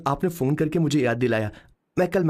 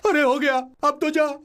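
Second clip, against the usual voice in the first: tearful plea; 10 semitones higher, slower than usual. Acted. Crying, begging it to leave.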